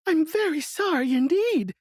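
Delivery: put-on frightened girl. A narrator's reading. Dorothy apologising to the melting witch, frightened. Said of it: "truly frightened".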